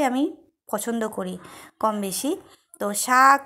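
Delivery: chewing food while speaking; talking around a mouthful; mouth full. Real, not acted. Seen 1: Puts greens and rice in mouth at 0.6, then chews with hand at lips.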